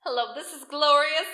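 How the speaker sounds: booming, stretched-out hello; phone greeting impression. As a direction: answer big and slow, pitch lifted. Imitating Glorious answering the phone.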